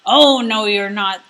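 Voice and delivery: loud gruff troll refusal; troll blocking the middle goat; troll shouting. Acted. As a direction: bark it like a troll. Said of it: "the troll shouted".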